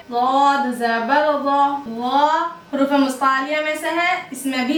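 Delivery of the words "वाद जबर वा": वाद जबर वा said slowly and thick; careful Quran letter drill; heavy letter drill. Shown as a demonstration. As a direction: spell out वाद heavily. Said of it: "कैसे पढ़ेंगे".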